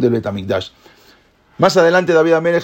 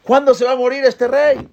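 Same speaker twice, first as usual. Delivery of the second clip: shouting enemies jeering at the king; 9 semitones higher, slower than usual. Retold or imitated. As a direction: taunt the king from outside the palace. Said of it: "empezaban a gritar".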